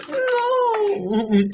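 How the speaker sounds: put-on high, tearful begging over a dying girl; crying plea. Man begs dying Allison, crying and high. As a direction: beg her through tears not to die.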